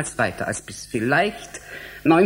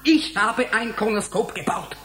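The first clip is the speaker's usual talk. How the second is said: higher, indignant retort; 8 semitones higher than usual. Acted. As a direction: bristle at his doubt and prove him wrong. Scientist bristles at being doubted.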